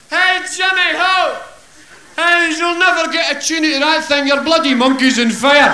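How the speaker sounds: put-on high, loud drunken Glasgow yell; drunken shout. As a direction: holler across the dike at the manservant. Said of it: "he shouted".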